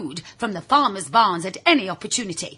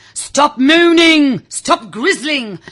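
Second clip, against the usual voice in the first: slow, loud parental shout, much higher; scolding cry; 6 semitones higher, 9 dB louder, slower than usual. Retold or imitated. Parents of old warning grizzling children, loud slow scolding. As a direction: bark it out like a cross parent. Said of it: "would be the cry".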